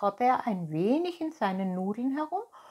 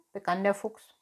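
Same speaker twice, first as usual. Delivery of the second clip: ordinary delivery, nothing marked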